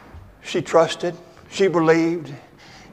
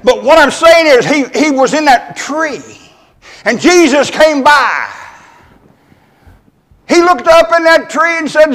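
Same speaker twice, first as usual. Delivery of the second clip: raised, higher preaching voice; fervent preaching; 9 semitones higher, 8 dB louder than usual. Acted. Preacher resuming the story, louder and higher. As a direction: swell back into the sermon.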